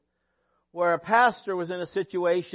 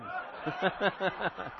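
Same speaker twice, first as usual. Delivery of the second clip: soft run of chuckles; quiet, rippling real laugh; 7 dB quieter than usual. Real, not acted. Preacher amused by his own TV favorites, chuckling. Chuckles mid-list of TV shows.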